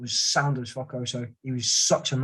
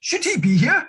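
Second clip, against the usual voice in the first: much higher, put-on scoffing voice; incredulous mock voice; 14 semitones higher, 5 dB louder than usual. Sergeant mocking the idea of a weak lad, high and scoffing. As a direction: squeak it out like a baffled soldier. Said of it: "I was just like".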